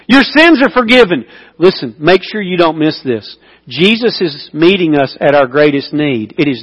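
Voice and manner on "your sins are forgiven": Your sins are forgiven ringing out high and strong; proclaimed. Acted. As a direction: declare it like a verdict. Climax of healing story.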